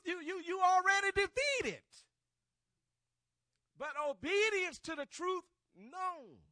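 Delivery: high, loud preacher's cry with drawn-out words; full-force preaching. Real, not acted. Preacher to the church on obedience to truth, full-force preaching. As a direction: drive the truth home to the congregation.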